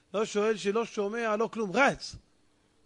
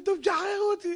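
high-pitched, hammed-up poor-me whine; pitiful pleading; 11 semitones higher, faster than usual. Captured man asks soldiers for release, pitiful pleading. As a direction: beg like a helpless wretch. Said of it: "הוא עושה פרצוף כזה של מסכן".

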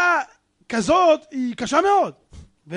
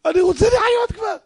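high, loud acted plea; desperate outcry; 9 semitones higher, 6 dB louder than usual. Poor man begs prophet for life, desperate high shout. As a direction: burst out high in mock despair.

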